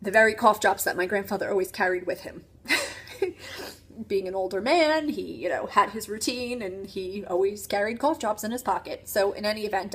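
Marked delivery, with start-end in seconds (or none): 2.7-3.7 a short, high, airless laugh; breathless laugh. Real, not acted. Recalling the grandfather's cough drops, breathless laugh. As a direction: laugh in disbelief.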